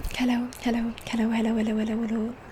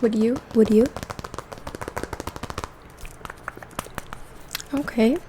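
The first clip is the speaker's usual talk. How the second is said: gentle half-whispered soft speech; soft spoken voice. Real, not acted. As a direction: speak softly, like a calm spa host. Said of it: "this video is going to be in a soft spoken voice".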